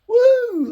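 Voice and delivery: loud, high whoop of delight; pleased with the wiring, a loud high whoop; excited whoop. Real, not acted. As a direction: let out a big happy whoop. Delighted at a finished wiring step.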